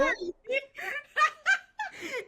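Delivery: high-pitched burst of laughter; real high laugh. Real, not acted. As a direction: break into a high cackle. Laughing at own story.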